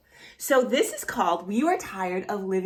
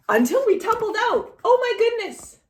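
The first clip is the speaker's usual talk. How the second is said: much higher, louder storybook alarm; mock shock; 13 semitones higher, 5 dB louder than usual. Reading the fall from the tree, hammed-up shock. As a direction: play the twist big for the kids.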